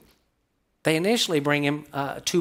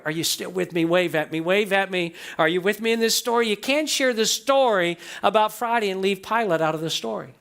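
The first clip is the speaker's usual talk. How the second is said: normal talking voice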